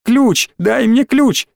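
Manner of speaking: put-on high, frantic child demand; almost shriek it, pitched right up; nearly squealing. A narrator's reading. Small boy demands key from older one, nearly squealing. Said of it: "Мелкий почти визжал".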